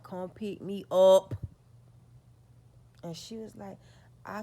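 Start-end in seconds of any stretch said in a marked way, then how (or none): 0.0-1.3 loud call. Retold or imitated. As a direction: shout it slowly and loud. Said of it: "I was like".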